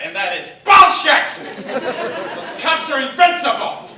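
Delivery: loud, slow laughing outburst; laughing rant. Real, not acted. Comic ranting about the ad, loud and laughing. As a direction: explode at the lie.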